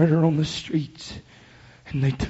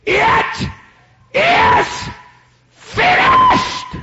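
each word climbing far higher in a rising shout; loud dying cry; 5 dB louder, much slower than usual. Acted. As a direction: scream it out in triumph to the heavens. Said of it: "He screamed with a loud voice".

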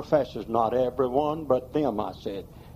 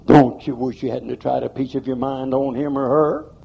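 normal talking voice

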